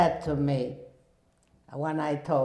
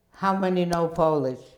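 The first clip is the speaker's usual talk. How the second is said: ordinary talk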